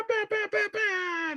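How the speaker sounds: high, slow sing-song bah bah bah; imitated soundboard sound. Streamer to chat, mimicking his lost soundboard in a high slow sing-song. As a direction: stretch it out up high like a sound effect. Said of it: "my little bah".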